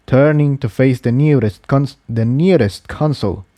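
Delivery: usual speaking voice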